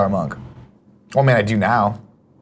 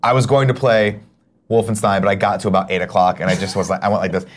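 normal talking voice

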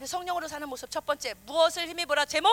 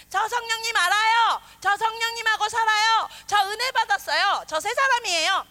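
smug believers; 7 semitones higher, 10 dB louder than usual. Retold or imitated. Mocking boastful believers.

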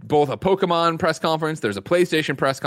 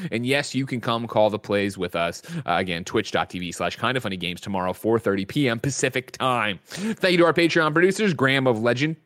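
ordinary talk

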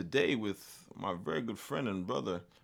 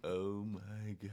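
slow drawn; 7 dB quieter, slower than usual. Pretend. Echoing catchphrase.

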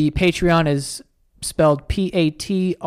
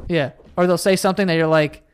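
normal talking voice

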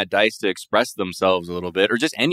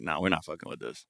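much softer, dismissive throwaway; subconscious voice; 3 semitones lower, 12 dB quieter than usual. Retold or imitated. Her subconscious dropping a show, soft dismissive aside. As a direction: murmur it like an inner voice losing interest.

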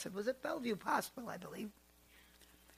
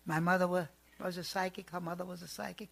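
usual speaking voice

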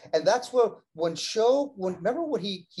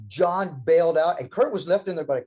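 ordinary delivery

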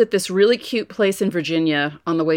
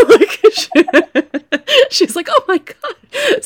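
high squealing laugh through the words; 8 dB louder, slower than usual. Retold or imitated. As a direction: crack up mid-sentence. Woman bursts out laughing.

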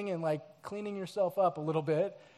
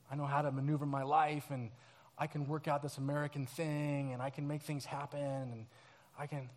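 softer, lower muttering of pride; quiet proud self-talk; 6 semitones lower, 9 dB quieter than usual. Pretend. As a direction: reassure yourself under your breath. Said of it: "in our pride, we think".

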